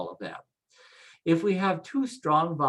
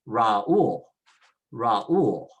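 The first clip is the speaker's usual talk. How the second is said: "Raul" as ra-OOL, weight on the u; "Raul" in two syllables, second one stressed; 3 semitones lower, 5 dB louder than usual. Shown as a demonstration. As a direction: split a and u, punch the u in "Raul". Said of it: "they want you to stress it".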